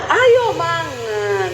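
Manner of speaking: very slow, drawn-out call to eat; imitated Javanese lilt, greatly elongated; attempted Javanese softness. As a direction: stretch it out, high then sinking. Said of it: "jadi saya panggil dia".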